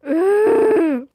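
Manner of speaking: loud pained grunt; pained groan. Acted. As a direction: groan as if bones crack. Imagined crackling sound groan.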